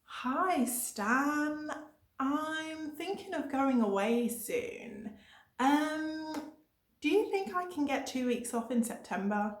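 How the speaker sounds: high, hesitant holiday request; asking the boss. Acted. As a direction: draw it out high, testing the water with the boss. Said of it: "go to your boss and say".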